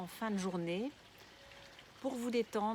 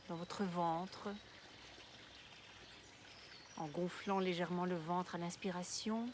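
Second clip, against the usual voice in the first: guided meditation; 6 dB quieter than usual. Real, not acted. Guide une relaxation de yoga nidra. (voix douce et posée de méditation guidée)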